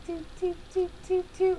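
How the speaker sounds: high imitated toot-toot; string out small high engine toots; sputtering car toots. Little car runs out of gas, high sputtering toots. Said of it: "And it just like".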